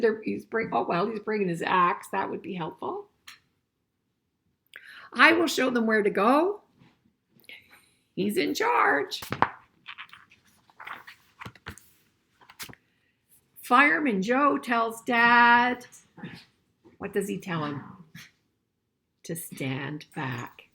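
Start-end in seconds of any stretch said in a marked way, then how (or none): 5.1-15.9 put-on reading a picture book aloud to kids, a bit louder; storybook reading voice; story reading. A narrator's reading. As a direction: read it out clearly for the class.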